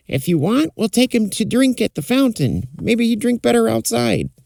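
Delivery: put-on stable boy voice. Stable boy talks to other.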